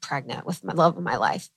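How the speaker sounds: low, soft daydreaming sigh; wistful longing. Retold or imitated. Imagining her at Fashion Week longing for home, low and soft. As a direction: dream of being home, softly. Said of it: "been like".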